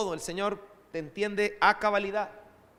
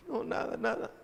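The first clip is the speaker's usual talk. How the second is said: put-on small child voice; 7 semitones higher, 4 dB quieter than usual. Crying daughter answers father.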